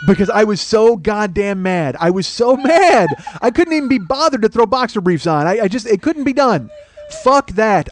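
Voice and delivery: loud, high-pitched furious rant; worked-up angry tirade. Retold or imitated. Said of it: "but to this right here".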